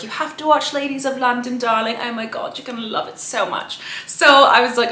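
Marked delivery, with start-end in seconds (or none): 0.0-3.8 British friend gushing in a put-on accent. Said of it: "That's, like, my British accent".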